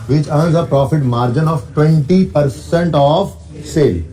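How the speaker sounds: slow, deliberate reading of the question; dictating the exam question slowly; slow dictation. Shown as a demonstration. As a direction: read it out word by word. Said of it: "reading the question slowly".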